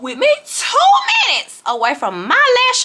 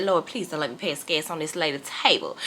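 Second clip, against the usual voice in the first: soft, low plea to the Lord; nervous whispered prayer; 7 semitones lower, 8 dB quieter than usual. Retold or imitated. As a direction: pray under your breath, nervous. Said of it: "I was so nervous".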